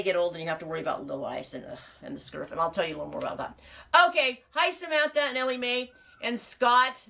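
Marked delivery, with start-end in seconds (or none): 4.1-7.0 high, louder, slowed greeting of names; bright greeting. Real, not acted. A host welcoming livestream viewers by name, high and bright. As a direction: greet each viewer with a lift.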